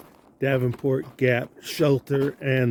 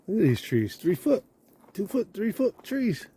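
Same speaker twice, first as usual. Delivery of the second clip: amazed; 7 semitones higher, 3 dB quieter than usual. Real, not acted. Marveling at big trees.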